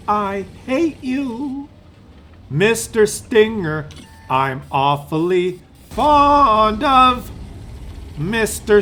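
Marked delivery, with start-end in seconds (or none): 0.1-1.7 mock taunt. Taunting the enemy tank in a teasing put-on voice. 4.3-7.3 mock fond. Mock crooning to the enemy tank, slow and sweet.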